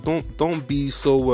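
normal talking voice

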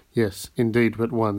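ordinary delivery, nothing marked

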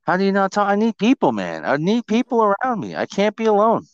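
high-pitched comic plea; mock pleading. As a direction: whine playfully that you need company. Comic plea for needing company.

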